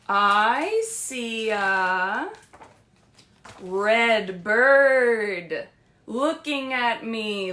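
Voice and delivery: loud, very slow storybook reading; read-aloud. Acted. Librarian reading Brown Bear to young listeners, loud slow storybook voice. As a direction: stretch every word out for the kids. Librarian reads picture book line.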